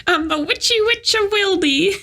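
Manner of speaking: high witch voice through laughter. Acted. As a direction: giggle through the witch intro.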